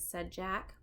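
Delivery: ordinary delivery, nothing marked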